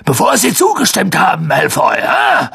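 high, rough, furious shout; explosive, rough-voiced rage; blustering outburst. A narrator's reading. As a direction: roar an accusation at full tilt. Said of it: "polterte er los".

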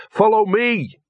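high-pitched urgent command; urgent hiss. A narrator's reading. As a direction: call the dog after you, strained. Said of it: "hissed Gaspode".

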